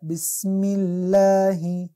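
بسم الله with الله said thin, light lam; slow recited بسم الله, thin الله; thin Allah. Shown as a demonstration. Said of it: "اینجا الله باریک شد".

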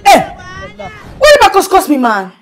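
loud high indignant shout; angry protest. Real, not acted. Caller protests the host cursing her, loud and angry. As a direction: cut in hard, offended.

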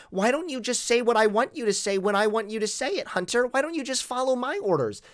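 a hint of a nagging Karen; mocking Karen. Streamer mimicking the accuser as a bossy Karen. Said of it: "she's like a major Karen".

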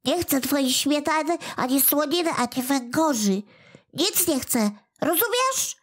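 high, huffy goblin voice; indignant little creature. A narrator's reading. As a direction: refuse everything in a petulant outburst. Kłobuk refusing the cat.

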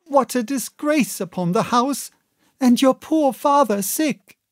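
alarmed mother; 1 fretting mother. A narrator's reading. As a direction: fret in a mother's voice. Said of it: "cried my mother".

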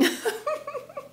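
short high giggle through stiff lips; a quick squeaky laugh you try to hold back; stifled high laugh. Real, not acted. Laughing with mask on.

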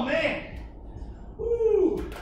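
ordinary delivery

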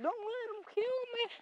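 high squeaky plea for the pig; pig's pleading voice. Pretend. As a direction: voice the scared pig begging for her life. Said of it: "looking back at me like".

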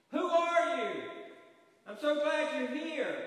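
high loud relieved calling; relieved cry. Acted. Man on the branch cries out to the voice in relief. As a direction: call out with desperate relief.

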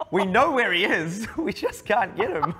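slow, high, loud mock complaint; hammed-up helplessness, breaking into laughter; mock helpless player. Voicing the team stuck against Zonda, mock helpless.

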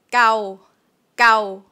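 gao with a clipped, short vowel. Shown as a demonstration. Teacher modelling the short vowel for students. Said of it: "Short version".

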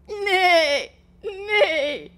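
high, loud whinny; whinny like a horse. Acted. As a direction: horse neigh nay nay. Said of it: "whinnied the horse".

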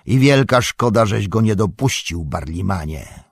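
loud opening, falling away; emphatic rebuke settling onto the name; sharp reproach. A narrator's reading. Strider to innkeeper who kept him out, reproachful.

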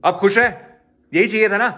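exasperated brother snapping at his sisters; bitter snap. Real, not acted. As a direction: throw it at them in frustration. Fed-up brother snaps at sisters.

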